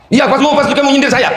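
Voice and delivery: mock outraged husband pressing; accusing husband.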